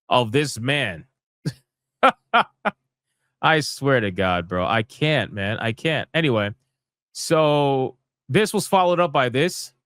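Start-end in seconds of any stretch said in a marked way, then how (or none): none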